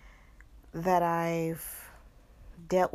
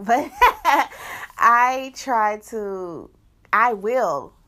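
laughing; 5 semitones higher, 10 dB louder, slower than usual. Real, not acted. Flirting confession, laughing.

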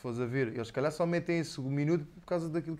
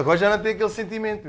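laughing; 8 semitones higher, 9 dB louder, faster than usual. Real, not acted. Thank-you speech interrupted.

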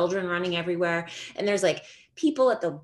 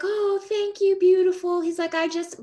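put-on sweet old man; 12 semitones higher, 4 dB louder than usual. Imitating the old neighbour's high sweet thanks as she holds the door.

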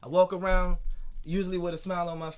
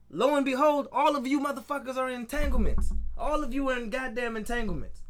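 higher and louder, fed-up rant; venting at viewers, higher and louder with real frustration; heated rant; 7 semitones higher, 4 dB louder than usual. Real, not acted. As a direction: call everybody out, exasperated. Ranting at people in entanglements.

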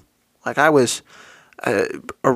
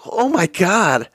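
slow, higher strained gasp of surprise; stunned disbelief; 6 semitones higher, slower than usual. Retold or imitated. Young man lifting neighbour, stunned by the weight. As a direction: drag it out, shocked at the weight.

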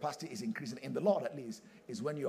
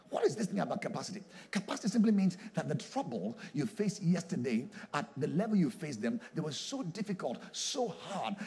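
usual speaking voice

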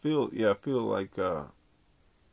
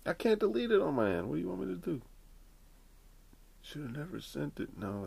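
normal talking voice

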